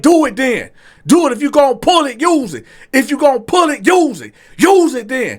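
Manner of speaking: loud, high acted taunting; goading dare. Customer daring the waiter to use the knife, goading dare. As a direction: egg him on, punching each command.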